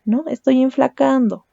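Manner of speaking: ordinary delivery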